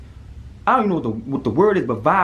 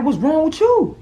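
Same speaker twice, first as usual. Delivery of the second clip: alarmed friend; 6 dB louder, slower than usual. Retold or imitated. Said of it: "He like".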